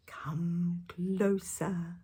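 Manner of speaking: very slow, creepy whispered invitation; sinister whisper. Acted. Wolf luring the girl closer, whispering. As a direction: lure her in with a hiss.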